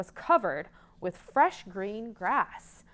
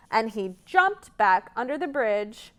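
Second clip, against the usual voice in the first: ordinary delivery, nothing marked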